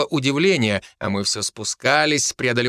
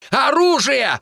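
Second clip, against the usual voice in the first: high, urgent battle shout; high-pitched alarm cry; yelling; 13 semitones higher, 4 dB louder, slower than usual. A narrator's reading. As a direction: yell a call to arms. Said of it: "заорал я".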